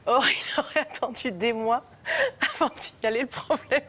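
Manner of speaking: reading through laughter; giggling through the sentence; laughing. Real, not acted.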